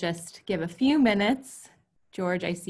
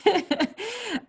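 high-pitched giggle; light laugh. Real, not acted. Host amused by own remark on confusing people, laughing. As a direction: break into a high laugh after speaking.

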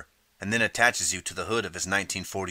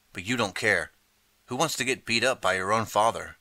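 ordinary delivery, nothing marked

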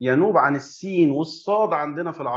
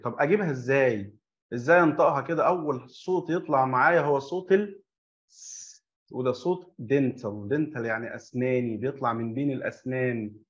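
normal talking voice

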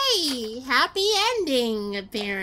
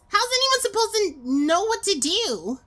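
frustrated outburst; 10 semitones higher, 5 dB louder than usual. Real, not acted. Frustrated at fast message.

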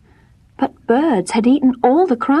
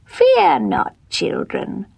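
witch voice; 5 semitones higher, slower than usual. Acted. Witch greets the children.

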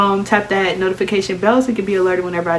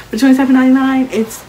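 excited price hype; 6 semitones higher, 6 dB louder, faster than usual. Real, not acted. Hyping the price.

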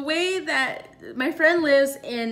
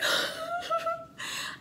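high squeaky nervous laugh; panicked squeal laugh; 6 dB quieter than usual. Retold or imitated. Alarm about to blow, she squeals in panic.

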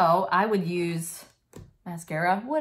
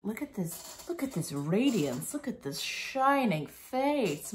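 playful marveling, building to drawn-out singsong; hammed-up admiration, stretching and lifting at the end; mock awe; 4 semitones higher, slower than usual. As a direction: marvel theatrically at a radiant face. Mock admiring the highlighted face.

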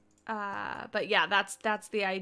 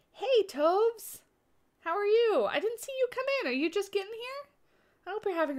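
excited greeting; 11 semitones higher than usual. Real, not acted. Greeting a chat viewer.

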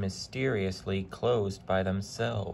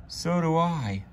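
high, louder engine voice; eager agreement; 8 semitones higher, 4 dB louder than usual. A narrator's reading. Henry agreeing with his driver, eager to go back. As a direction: agree quickly, keen to leave. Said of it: "agreed Henry".

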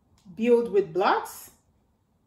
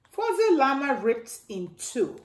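plain reading voice

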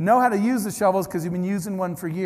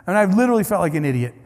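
usual speaking voice

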